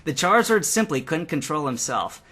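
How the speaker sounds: plain reading voice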